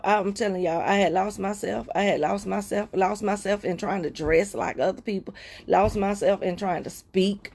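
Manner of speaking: ordinary talk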